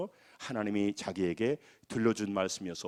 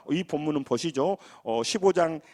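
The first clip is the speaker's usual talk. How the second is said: normal talking voice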